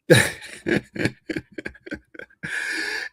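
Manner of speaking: real wheezy, breathy laughter; long helpless giggling fit; laughing. Real, not acted. Amused streamer reacting to a man's videos, laughing. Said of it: "I'm laughing because".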